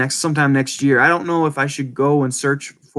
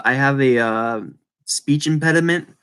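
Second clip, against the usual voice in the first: normal talking voice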